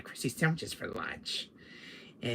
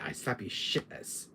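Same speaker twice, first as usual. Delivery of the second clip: usual speaking voice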